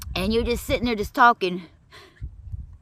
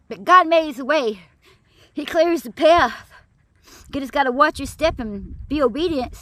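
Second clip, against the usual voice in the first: preaching; 3 semitones higher than usual. Real, not acted. Preaching encouragement uphill.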